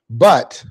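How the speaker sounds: "But" hit low and loud; "But" punched as the underlined word; stressed but. Shown as a demonstration. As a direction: lean hard on "But". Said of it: "underline comma".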